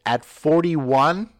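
loud, high, drawn-out disbelief; incredulous question. Real, not acted. Host doubting an aging player's comeback, incredulous. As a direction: question it with a big rising finish.

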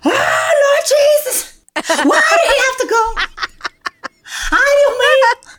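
high, loud mock wail with laughter; hammed-up funeral wail, laughing through it; dramatic wailing mourner. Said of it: "people are like".

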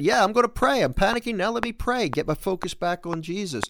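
eager and determined, in Peter's voice; Peter resolving to pray. Acted. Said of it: "He finally said".

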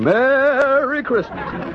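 drawn-out, high imitation of a store Santa; detective mimicking the Santa's yell; mock Santa yell. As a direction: ham up the Santa's holiday call. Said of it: "that guy keeps yelling".

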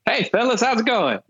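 high, overly cheerful greeting; fake friendly. Scared driver greeting the Klansmen, in a high, fake-cheerful voice. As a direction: sound falsely bright and harmless.

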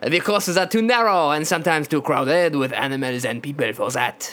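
laboured, thick mock French accent; exaggerated French accent. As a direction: explain it as a caricature French official. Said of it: "I would have to say in this accent".

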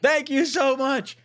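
high, drawn-out shouted thanks; excited shouting. Real, not acted. Host thanking a viewer for a huge donation, excited shouting. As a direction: gush loudly, ending in a yell.

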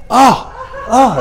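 high panicked yelps; mock freak-out. Imitating people with dirt in the eye, high mock-panicked yelps. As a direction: yelp in exaggerated alarm. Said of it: "they freak out".

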